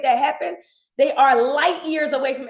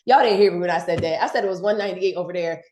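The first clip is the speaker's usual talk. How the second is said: usual speaking voice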